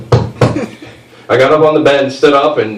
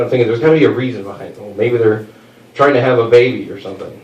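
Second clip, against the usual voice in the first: ordinary talk, nothing marked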